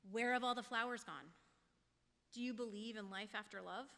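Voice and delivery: ordinary talk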